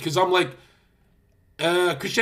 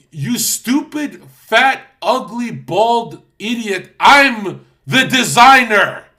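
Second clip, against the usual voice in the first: slow furious insults building to a yell, much higher and louder; outraged designer building to a shout; insulting rant; 5 semitones higher, 8 dB louder than usual. Retold or imitated. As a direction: berate a dumb business partner. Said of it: "I'm like".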